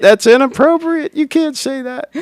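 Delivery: high, loud prudish complaint; prim scandalized voice. Pretend. Mock scandalized viewer voice.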